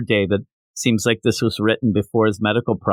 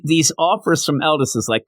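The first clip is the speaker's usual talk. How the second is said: ordinary talk, nothing marked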